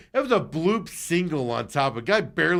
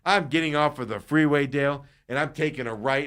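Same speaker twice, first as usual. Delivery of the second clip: ordinary talk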